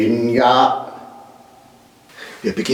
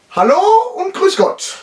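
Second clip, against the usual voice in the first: cheery greeting. Acted.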